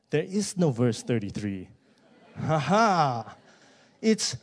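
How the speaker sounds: ordinary talk, nothing marked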